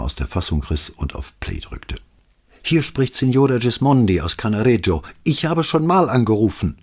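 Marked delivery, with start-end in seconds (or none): 2.6-6.8 high, tight voice held back by anger; anger-pressed complaint. A narrator's reading. Neighbour complaining to the police by phone, tightly angry. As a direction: squeeze each word out through anger. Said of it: "der zorngepresste Ton war ihm fremd".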